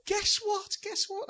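put-on very high child-like voice; imitated excited daughter. Daughter with news for dad, imitated high and excited. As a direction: mimic a child bursting with news. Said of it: "Ruth said".

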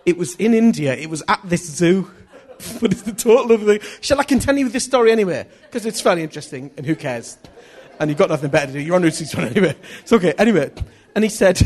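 loud, quick, laughing through the words. Real, not acted. Preacher admitting the wrong story to his audience, laughing loudly. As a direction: carry on through real, helpless laughter.

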